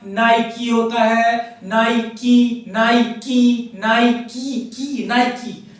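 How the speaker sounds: नाइकी slowly, with the ending की clear; Nike as naiki. Shown as a demonstration. Teacher drilling the brand name नाइकी for students. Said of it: "नाइक नहीं होता".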